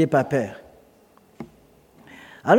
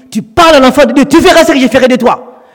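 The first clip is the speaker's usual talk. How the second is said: loud, raised warning from above; God's loud, high warning voice at night; threatening divine voice; 7 semitones higher, 7 dB louder than usual. Retold or imitated. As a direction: thunder the warning at him.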